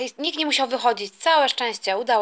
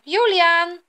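raised, louder call to son; 4 semitones higher, 5 dB louder than usual. Acted. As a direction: call him from another room. Parent calls son from another room.

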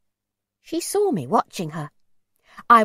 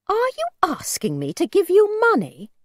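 high-pitched, affronted question; indignant suspicion; 10 semitones higher, 4 dB louder than usual. A narrator's reading. Mother confronts a stranger asking for money, indignant. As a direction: challenge him in haughty disbelief.

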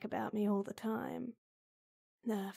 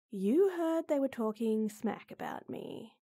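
normal talking voice

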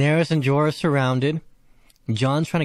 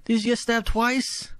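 high, slow pained lament; distressed; 12 semitones higher, slower than usual. Real, not acted. Fan watching Jorah shield Daenerys, slow high pained lament. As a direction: drag it out, hurting for him.

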